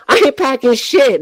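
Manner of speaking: normal talking voice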